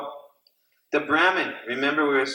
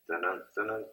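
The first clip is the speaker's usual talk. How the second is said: suspense hum; 4 semitones lower, 9 dB quieter than usual. Acted. Building suspense in the story.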